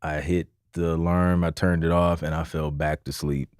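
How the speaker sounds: soft, low, sleepy excuse; sheepish quiet explanation. Retold or imitated. Husband explaining to wife he dozed off, softly. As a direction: mumble it like you just woke up. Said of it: "it's like".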